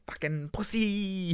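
high, drawn-out Aussie taunt; mock Aussie accent jeer. Mates jeering when you can't do a trick, mock Aussie accent. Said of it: "in the Aussie accent".

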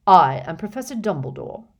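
firm, loud self-introduction. Acted. Professor to wary orphan boy, firm introduction. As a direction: announce yourself with weight. Dumbledore introduces himself firmly.

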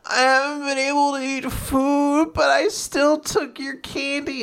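high, drawn-out mock sobbing; sobbing little girl. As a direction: wail it like a tearful child, hammed up. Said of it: "And she's sobbing".

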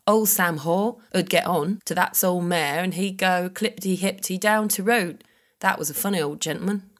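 best attempt at a North Norfolk accent. Shown as a demonstration. Podcaster reading a villager's words from the journal, North Norfolk accent. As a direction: read old dialect phonetically in North Norfolk accent. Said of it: "in my best North Norfolk accent".

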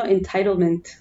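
entitlement in American English accent. Shown as a demonstration. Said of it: "שאני אומרת במבטא אמריקאי".

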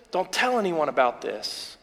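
soft, low, a gentle hush to the crowd; quietly urging secrecy; hushed warning. Acted. Jesus quietly telling the crowd to keep it secret, hushed.

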